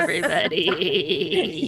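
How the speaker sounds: bleating, slow goat voice. Acted. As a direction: talk slowly like a goat. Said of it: "but with a goat voice".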